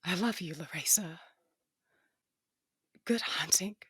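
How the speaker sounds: faint breathless whisper of a dying woman. Acted. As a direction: breathe out the last goodbye. Dying sister's last farewell.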